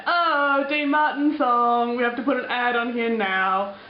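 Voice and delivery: loud, slow mock robotic announcer; YouTube's voice. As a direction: play the pushy website announcing an ad. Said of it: "YouTube's like".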